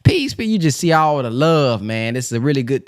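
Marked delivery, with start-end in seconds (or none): 0.0-0.4 mock greeting, jumping high; imitating the believers' greetings. Host mimicking believers greeting each other at Savior's Day. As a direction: chirp the greetings like the crowd at the event. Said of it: "You get to hear everybody".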